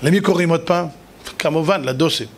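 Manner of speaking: ordinary talk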